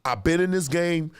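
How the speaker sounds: ordinary talk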